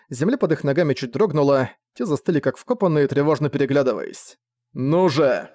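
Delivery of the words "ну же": ordinary delivery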